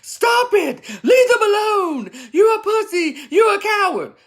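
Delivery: high, loud, tearful yelling at police; imitating screaming bystander. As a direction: scream it like a bystander near tears. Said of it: "you yelling at them".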